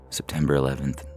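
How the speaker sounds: plain reading voice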